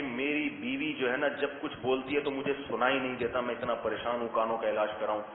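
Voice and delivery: lightly voiced weary husband pleading; henpecked man. A husband telling the doctor he can't hear his wife, low and weary.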